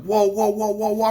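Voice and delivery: high startled whoas; alarmed high-pitched whoa. Retold or imitated. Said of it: "And I was like".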